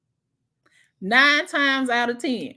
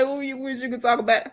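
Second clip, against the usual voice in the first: sassy retort; faster than usual. Retold or imitated. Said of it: "I said".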